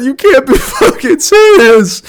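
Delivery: high, slow, strained wail of disbelief; anguished wail. Retold or imitated. Telling a friend how bad it was, high anguished wail. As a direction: wail it out like you're about to cry. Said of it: "ready to scream, cry".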